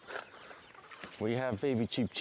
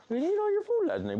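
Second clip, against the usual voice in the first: very high, louder mock scolding; 4 dB louder than usual. Real, not acted. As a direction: playfully tell off the pets.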